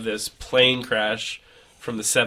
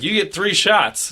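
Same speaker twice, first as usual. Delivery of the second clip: quoted bartender; 8 semitones higher, 4 dB louder, slower than usual. Retold or imitated.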